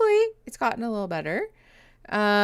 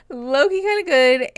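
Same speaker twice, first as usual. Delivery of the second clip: emphatic; 6 semitones higher, 9 dB louder than usual. Real, not acted. Repeats her confession with emphasis.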